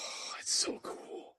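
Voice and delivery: very soft, drawn-out whisper; awed whisper. Real, not acted. Chiming in on a favourite movie scene, whispered admiration. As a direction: breathe it out slowly under your voice.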